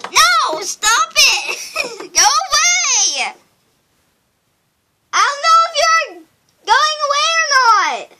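high, loud yelling with laughs; laughing panicked shout. Pretend. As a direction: shriek it, half laughing.

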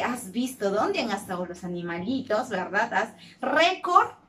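ordinary talk

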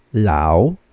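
"lao" long, dipping low then rising; third tone. Shown as a demonstration. Teacher drilling learners in third tone, "lao" dipping and rising. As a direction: sink down on "lao" and climb back up. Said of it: "how would we read this third tone".

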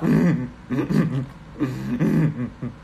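put-on throaty laugh in short bursts; deliberately forced, stuttering chuckle; practiced new laugh. Shown as a demonstration. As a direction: try out an odd fake laugh. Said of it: "I'm practicing a new laugh".

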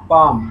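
পাম said with the L left silent; teacher's model of পাম, long "aa" and no L. Shown as a demonstration. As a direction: say পাম as "paam", no L sound. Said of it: "উচ্চারণে খুবই খেয়াল রাখতে হবে".

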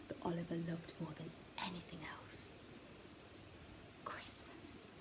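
whispered storytelling, drawing the listener in; hushed whisper. A narrator's reading. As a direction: breathe it like a secret, Christmas barely voiced.

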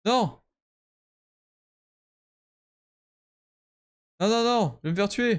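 high panicked cries of no. Real, not acted. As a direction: panic as death looms. About to be killed in game.

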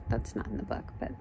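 ordinary delivery, nothing marked

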